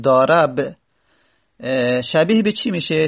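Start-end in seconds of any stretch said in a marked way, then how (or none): 0.0-0.7 ضارب said "daarab", a in the last syllable; fatha on the ra. Shown as a demonstration. Teacher trying a hypothetical form, fatha on second syllable. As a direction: give ضارب an "a" before the final b. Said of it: "فتحه رو تقدیر بکنیم بگیم".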